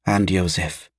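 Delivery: hushed, falling motherly confirmation; quiet, subdued echo of the worry; soft agreement. A narrator's reading. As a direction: agree gently, under your voice. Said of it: "agreed their mother".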